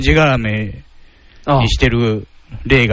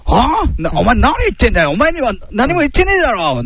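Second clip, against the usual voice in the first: high, hot-tempered retort from a cop drama; angry TV drama line; 10 semitones higher than usual. Retold or imitated. Re-enacting [name]'s famous TV outburst at [name], high and angry. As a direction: snap back at her like the actor did in the scene. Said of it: "の、あの時の演技で。".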